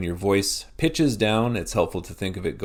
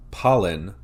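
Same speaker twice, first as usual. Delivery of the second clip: "pollen" with the first syllable punched louder; first-syllable stress; 5 dB louder than usual. Shown as a demonstration. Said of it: "emphasize that first syllable".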